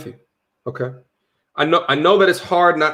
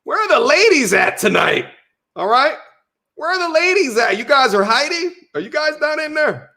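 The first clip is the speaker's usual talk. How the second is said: much higher, teasing calling out to the ladies; playful call-out; 9 semitones higher than usual. Pretend. Streamer calling out to women in chat, playful and high.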